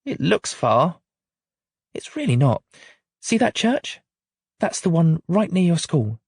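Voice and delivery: lightly voiced mum and little girl; mum and child voiced. A narrator's reading. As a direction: read the quarrel between mum and child with gentle character voices.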